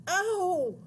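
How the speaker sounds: loud, high howl of pain; high yelled "ow"; yelp of pain. A narrator's reading. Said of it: "yelled Thwaites".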